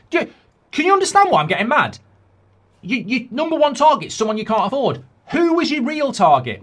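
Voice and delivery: high, loud, fast exasperated rant; mock angry rant. Fan getting mad at the club over its target, high and loud. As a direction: lose patience with them.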